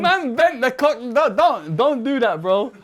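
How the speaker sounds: high-pitched, urgent protest; dismayed alarm. Real, not acted. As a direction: beg him to stop, pitched high in dismay. Dismayed at the bent lyric sheet.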